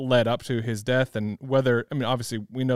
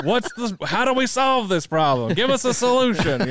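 higher-pitched, hammed-up demand for a fix; mock urgent problem-solving; 10 semitones higher, 4 dB louder than usual. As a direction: imitate an impatient need for answers. Mocks own urge to fix problems.

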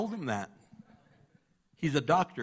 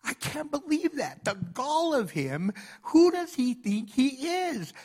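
a doctor fuming at a stranger's rebuke, indignant; indignant outrage; 9 semitones higher, slower than usual. Retold or imitated. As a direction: play the offended doctor fuming, slow. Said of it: "I felt like".

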